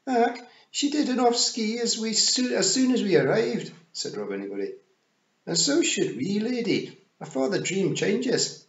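high, loud, urgent Scots pictsie; Rob Anybody. Acted. Rob urging Tiffany to leave the dream, high Scots voice.

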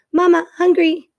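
high, loud peeping gosling cry; a baby bird begging for food; gosling calling. A narrator's reading. Hungry gosling calling his robot mother.

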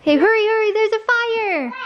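high, urgent pretend panic; pretend alarm. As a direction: call out the fake fire in a hurry. Pretend fire game with child.

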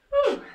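a very high, loud whoop; excited whoop. Real, not acted. Fitness coach pumping up her class, very high excited whoop. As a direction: squeal out a joyful whoop to pump everyone up. Coach cheering mid-workout.

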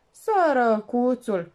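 mock pity. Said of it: "se hotărâ brusc".

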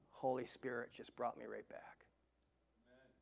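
almost voiceless, drawn inward; hushed whisper. Real, not acted. Preacher whispering how the Spirit brought him back. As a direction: breathe it out in a whisper. Prayer memory on his knees.

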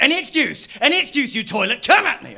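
loud, high mock challenge to a heckler; mock aggressive. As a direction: square up to him, hammed-up.

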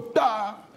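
sudden vocal bang; imitated gunshot. Hunter bragging of another duck shot, imitated bang. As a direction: voice the shot as one burst.

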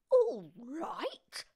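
high, outraged echo question; yelled. A narrator's reading. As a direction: yell it back, swooping upward. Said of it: "she yelled".

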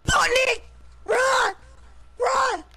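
panicked yelling. Real, not acted. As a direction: yell at him to run. Teammate under fire, yelling.

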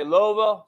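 Louisville opening on an oh sound, LOH-vill; Louisville as LOH-vul. Shown as a demonstration. Host listing how viewers say the city, Louisville as LOH-vill. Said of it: "some of you say".

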